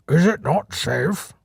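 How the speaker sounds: slow, lightly voiced young bull; a hint of a worried young bull, slow; slow, cautious young bull; Brutus's voice. A narrator's reading. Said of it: "he asked".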